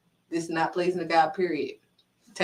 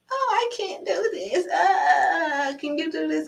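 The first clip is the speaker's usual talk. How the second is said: high-pitched, wailing mock whine; whining child; 8 semitones higher, slower than usual. Child begging a parent to lift it, whining. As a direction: imitate a helpless kid crying for help.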